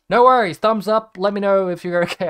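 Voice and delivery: imitating an easygoing guy, high and loud; loud reassurance. Guy reassures friend about to cry, loud and high. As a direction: call out a high, booming no-problem reply. Retold upbeat reply to a breakdown.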